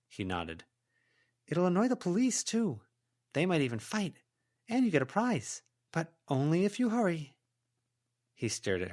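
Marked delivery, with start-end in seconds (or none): 1.4-7.4 higher, coaxing character voice; heroine coaxing a goblin. A narrator's reading. Heroine persuading a goblin to hunt down traps, coaxing. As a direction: sweet-talk the goblin into helping.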